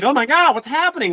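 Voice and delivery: high-pitched imitated freak-out; mock panic. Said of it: "They're like".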